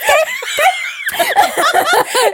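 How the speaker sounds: big high shrieking laugh; shrieking laughter at the joke; loud laugh. Real, not acted. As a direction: burst out laughing. Burst of laughter.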